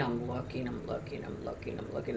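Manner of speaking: fading to a whisper. Retold or imitated. Searching the glass for the snake, fading to a whisper. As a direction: trail off while peering. Straining to spot hidden snake.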